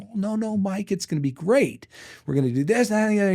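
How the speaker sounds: mock giddy optimism; eager naive reply. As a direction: brush off the warning like an excited kid. Retelling his naive eager reply.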